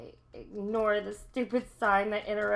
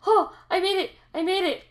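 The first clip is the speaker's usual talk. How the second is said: excited relief; 8 semitones higher, 5 dB louder, faster than usual. Real, not acted. Finally clears a hard jump.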